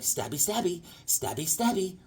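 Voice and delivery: soft, sing-song stabby Joker; a hint of a menacing clown; creepy Joker. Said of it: "He's like".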